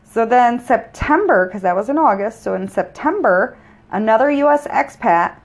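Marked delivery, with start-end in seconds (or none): none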